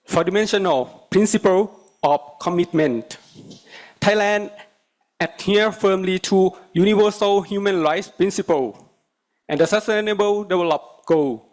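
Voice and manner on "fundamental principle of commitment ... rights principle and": English with a Thai accent; formal speech, Thai accented English. Real, not acted. Thai delegate giving formal speech.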